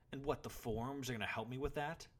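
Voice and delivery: high, loud scornful challenge; boy's angry retort; spat out. A narrator's reading. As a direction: spit the question back at him. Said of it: "spat the boy".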